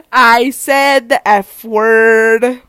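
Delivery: very loud, drawn-out punchline; slow, booming admission; loud confession. Real, not acted. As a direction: blurt it out loud, stretching each word. Blurts punchline after laughing fit.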